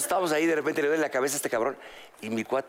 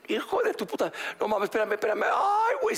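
higher, strained swearing that climbs to a squealing "ay"; mock freak-out; 6 semitones higher than usual. Drugged friend swears at the joke he was played, mock panic. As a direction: act out a tripping guy losing it.

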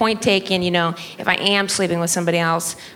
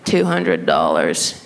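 very low, slow clerk impression; put-on deep, slow salesperson; store staff; slower than usual. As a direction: imitate the clerk, deep and deliberate. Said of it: "And they said".

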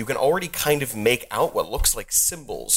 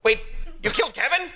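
shocked goblin squeak; faster than usual. Acted. Goblin boss shocked at news.

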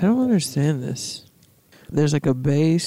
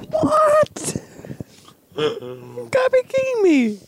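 squealing rant. Acted. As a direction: squeak it out hysterically. Squealing disbelief.

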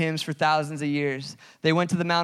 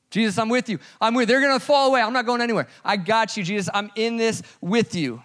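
higher and louder, rushing the eager promise; eager loyal vow; 8 semitones higher, 5 dB louder than usual. Acted. Peter pledging loyalty to Jesus, eager and higher. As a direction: Peter swearing he'll never leave.

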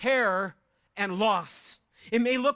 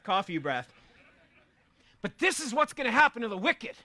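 ordinary talk

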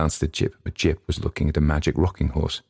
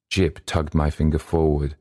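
usual speaking voice

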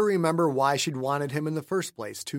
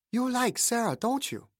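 put-on a childlike higher Jean; Jean's voice; 9 semitones higher than usual. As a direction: voice Jean sweetly and knowingly. Jean's voice in story.

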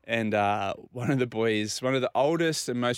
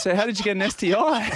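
high, louder incredulous question; retold disbelief, pitched right up; baffled questioning; 10 semitones higher, 5 dB louder than usual. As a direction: imitate a doctor who cannot believe it. Doctor questioning the puzzling claim.

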